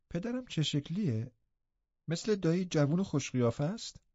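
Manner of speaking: put-on a sickly child asking, a little wistful; frail boy Linton. A narrator's reading. Linton asks Nelly about his new home, soft and curious.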